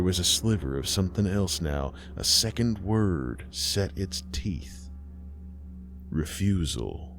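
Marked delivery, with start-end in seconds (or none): none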